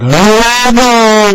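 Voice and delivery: very high, loud drawn-out wail; hammed-up wordless holler; exaggerated impaired-speech noise. Shown as a demonstration. Showing what the caller doesn't sound like, exaggerated wail. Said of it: "But it's not like".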